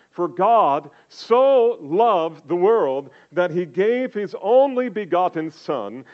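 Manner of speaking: proclaiming the verse to the congregation; scripture reading. Acted. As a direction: read the verse like it matters. Reads John 3:16 aloud.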